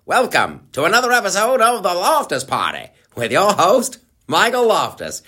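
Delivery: hammed-up vintage newsreel voice; 1950s narrator. Shown as a demonstration. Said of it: "like a nineteen fifties newsreel".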